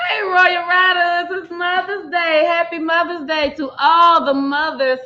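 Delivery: loud, slow, high hype welcome; excited greeting. Real, not acted. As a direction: call it out big and slow. Big greeting to viewers.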